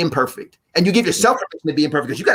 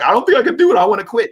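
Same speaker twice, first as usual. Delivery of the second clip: high, louder hammed-up whine; mock despair; 9 semitones higher, 4 dB louder, faster than usual. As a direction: act out the crying quitter. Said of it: "I'm crying like".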